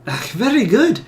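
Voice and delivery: high, loud exclamation, rising at the end; pleased approval. Acted. Researcher delighted by her subject's exchange, excited approval. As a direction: approve with keen interest.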